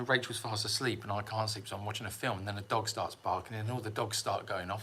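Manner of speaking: normal talking voice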